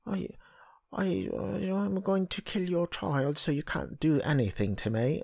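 high, soft mock British voice; put-on British accent. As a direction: mimic an actress faking a British accent. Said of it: "she's putting on, on a British accent too".

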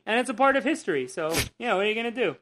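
ordinary talk

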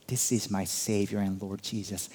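quiet awe of recognition; awed recognition. Acted. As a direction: breathe it out in wonder. Mary recognizing Jesus.

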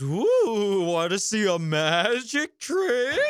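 high, slowed showy teasing voice; hammed-up magician's coo, drawn out; Demetria. Demetria offering the Rangers a magic trick, showy mock wonder. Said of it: "Demetria just goes".

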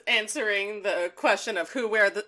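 ordinary talk

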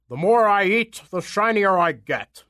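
put-on monster voice, loud start; self-satisfied giant apple voice; boastful giant. A narrator's reading. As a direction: gloat as a hungry giant.

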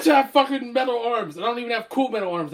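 high, exasperated acted complaint; mock indignant rant. Metal-armed man snapping back at criticism, mock indignant. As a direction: protest in mock outrage.

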